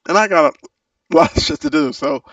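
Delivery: loud laughing, fast. Real, not acted. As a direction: laugh through the words. Laughs loudly mid-line.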